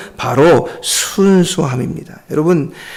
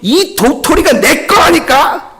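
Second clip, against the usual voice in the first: much higher, slow, heated laughing insistence; red-faced insistence; 10 semitones higher, slower than usual. Acted. Older rabbit flaring at little brother, heated insistence. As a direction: flare up and push the claim hard. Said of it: "형이 얼굴을 붉히면서".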